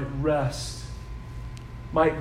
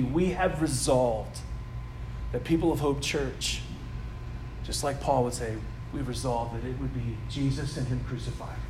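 soft heartfelt appeal; 7 semitones lower, 10 dB quieter than usual. Acted. Pastor tells the new church its heart's desire, softly. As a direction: lower and softer, intimate preaching.

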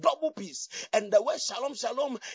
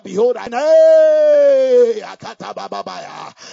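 loud, slow prophetic proclamation; voice of the Lord; 15 dB louder, slower than usual. Acted. Preacher voicing the Lord to congregation, booming slow proclamation. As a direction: thunder out the Lord's words. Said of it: "I hear the Lord saying".

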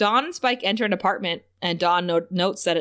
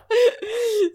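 high laugh; 13 semitones higher than usual. Real, not acted. Laughing at Glory.

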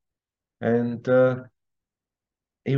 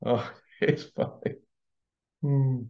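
laughing; 6 dB quieter, slower than usual. Real, not acted. Laughing at own story.

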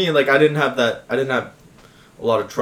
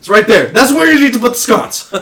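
higher, louder playful outburst; excited pointing; 10 semitones higher, 7 dB louder than usual. Pretend. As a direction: point it out with glee. Playful sconce joke.

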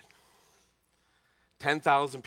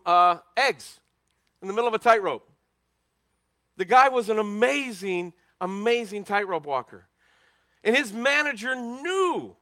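loud, high preaching run; full-force preaching; 7 semitones higher, 5 dB louder than usual. Acted. Pastor preaching about Blondin's manager, loud and high. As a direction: preach it big and rising.

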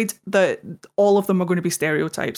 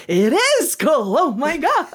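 rising, high-pitched amazed realisation; astonished discovery; 8 semitones higher than usual. Retold or imitated. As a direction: act out a big revelation.

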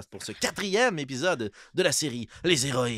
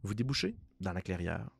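game master narration; 3 semitones lower, 3 dB quieter than usual. Acted. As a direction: set the scene, measured and grave. Game master sets the scene.

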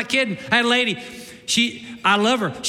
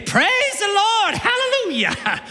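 put-on old lady's praise; 12 semitones higher, slower than usual. Emma Thompson breaking in mid-sermon, high slow praise. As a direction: lift it up like an old church lady. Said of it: "She'd just start saying".

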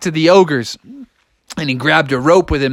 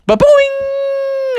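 very high, ringing boing; springy boing. Acted. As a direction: launch it like a giant spring. Imitates a huge jump.

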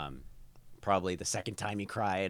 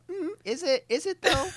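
high smug sneering voice; mock him with a high smug hum; 6 dB louder than usual. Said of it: "he just goes".

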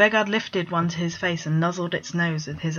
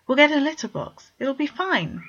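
cajoling; 9 semitones higher than usual. Acted. Vegard cajoling his brother.

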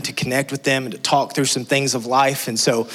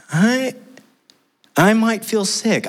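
put-on high, slow, uneasy child's voice; queasy daughter; 8 semitones higher, slower than usual. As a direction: voice a nervous little girl feeling sick. Daughter after first roller coaster.

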